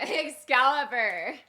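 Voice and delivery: half-hearted slow battle cry; battle cry attempt. Shown as a demonstration. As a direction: stretch out the name, holding back the yell. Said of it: "big battle cry yell".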